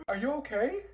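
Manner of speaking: ordinary talk, nothing marked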